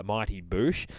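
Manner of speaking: normal talking voice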